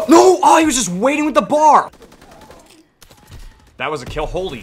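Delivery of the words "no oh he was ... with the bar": high, loud despairing shout; shocked outcry. Real, not acted. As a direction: yell at the ambush. Killed unexpectedly.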